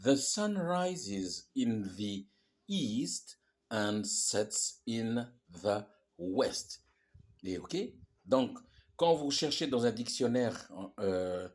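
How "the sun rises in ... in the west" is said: very slow and steady, every word separate; teacher rereads the sentence slowly for the class; slow rereading. Shown as a demonstration. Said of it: "je relis la phrase".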